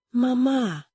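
high-pitched mild cry; cried out. A narrator's reading. Children cry to their dying mother, crying out. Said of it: "they cried".

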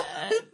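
shrill burst of laughter; high laugh. Real, not acted. Laughs at joke.

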